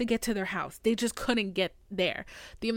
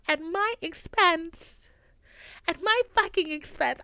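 indignant; 7 semitones higher than usual. Real, not acted. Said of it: "I was so mad".

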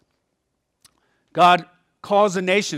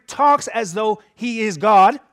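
talks pushed out louder and slower; stress on talks; 4 semitones higher, 6 dB louder, slower than usual. Real, not acted. Preacher to congregation, hitting talks slowly. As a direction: lean hard on talks, slow. Pastor stressing Jesus speaks as God.

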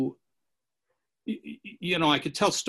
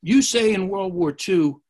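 louder, slower accusation; loudly saying; 5 semitones higher, 7 dB louder, slower than usual. Retold or imitated. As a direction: confront him loudly, slowing down. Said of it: "starts loudly saying".

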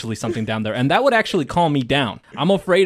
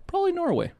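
high joking aside; 5 dB quieter, faster than usual. Real, not acted.

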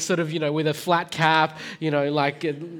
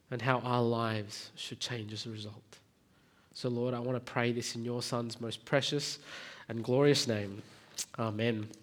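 softer, lower praying voice with thoughtful pauses; prayer; 6 semitones lower, 6 dB quieter than usual. Real, not acted. Preacher praying to the Lord before tonight's passage, hushed prayer. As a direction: pray quietly and unhurriedly, feeling for the words.

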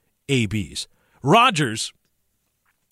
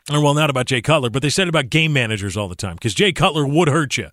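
ordinary talk, nothing marked

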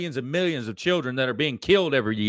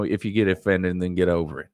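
normal talking voice

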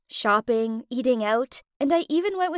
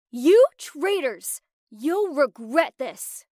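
loud, slow, drawn-out yell; screaming; 6 dB louder, slower than usual. A narrator's reading. Aaliyah to housemates after the vote, slow loud scream. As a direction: hurl it at them as she storms off. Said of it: "she screamed".

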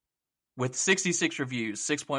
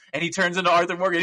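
ordinary talk, nothing marked

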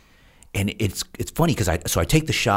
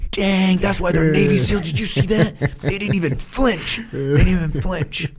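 much higher, louder awed soldier voice; impressed soldiers; 12 semitones higher, 4 dB louder than usual. Retold or imitated. Army guys marvel again, high awed voice. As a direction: gush like a wide-eyed Army guy. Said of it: "they're just like".

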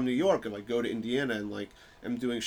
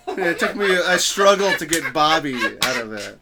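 ordinary talk

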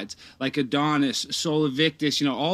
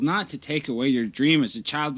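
ordinary talk

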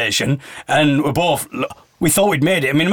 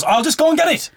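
eager stranger, much higher; high-pitched helper voice; 12 semitones higher than usual. Retold or imitated. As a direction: offer it brightly up high. Man voiced in retold rush.

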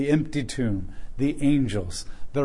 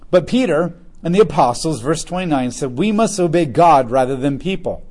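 raised, measured Bible reading voice; scripture reading; 2 semitones higher, 7 dB louder than usual. Acted. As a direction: read the passage out to the church. Reads scripture aloud to congregation.

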